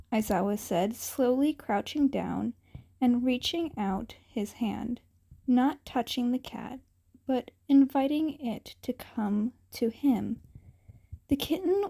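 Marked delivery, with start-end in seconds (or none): none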